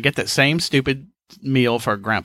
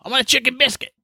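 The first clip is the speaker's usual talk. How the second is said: put-on high, insistent old-man voice; 11 semitones higher, faster than usual. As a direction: imitate the stubborn grandpa insisting. Grandpa insisting, voiced by son.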